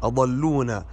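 "أضلونا" as adalluna; plural ending. Shown as a demonstration. Teacher shows another mistake, "أضلونا" said adalluna with long u. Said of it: "وهذا خطأ".

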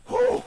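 loud high whew of relief; real sweaty whew. Real, not acted. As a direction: blow out a big whew from the heat. Wiping off heat after filming.

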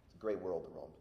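ordinary delivery

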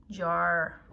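ordinary talk

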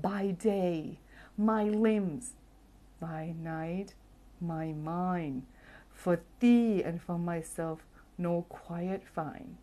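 slow, drawn-out reading of a Shakespeare sonnet; poem recitation. Shown as a demonstration. Host recites a sonnet to the class, slowly and theatrically. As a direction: savour each line like theatre verse. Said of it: "เชคสเปียร์มาเลยถูกไหมล่ะ".

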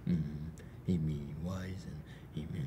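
tiny whispered hedgehog voice; shy snuffly hedgehog. Acted. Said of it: "Is that your hedgehog voice?".

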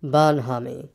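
low, glum donkey voice; gloomy. A narrator's reading. Eeyore carries on listing to Pooh, low and gloomy. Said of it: "went on Eeyore gloomily".

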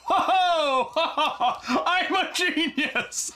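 high, slow mock triumphant cackle; gloating laugh. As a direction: gloat like a silly genius. Self-mocking gloat.

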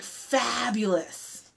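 "Fabulous" slow and soft, drawn out; savoured word. Shown as a demonstration. Teacher savouring the fun word "Fabulous" for kids. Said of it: "It's a fun word".

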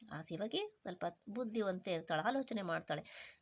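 usual speaking voice

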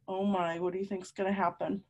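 normal talking voice

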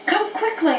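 high, urgent plea; begging. Acted. Dog begs mistress to hurry, urgent pleading. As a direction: beg in a high, anxious voice. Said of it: "I begged her".

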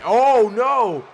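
high, loud gasp of disbelief; shocked shout. Real, not acted. Commentator reacting to a last-second kickout, shocked high shout. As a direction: yell it as the pin breaks at the last second.

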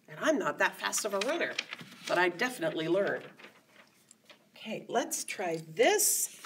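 usual speaking voice

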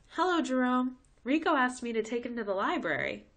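Rico's mom greeting Jerome; bright mom voice. Acted. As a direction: greet him like a perfect TV mom.

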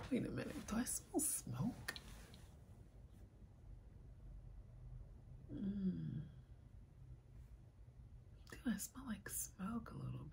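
whispered, wondering to herself; a curious whisper over a smoky scent; surprised whisper. Real, not acted. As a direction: hush right down, puzzled.

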